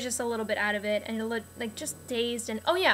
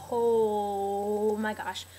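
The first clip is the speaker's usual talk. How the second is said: pained groan; much slower than usual. Retold or imitated. Numbness wears off, pain hits.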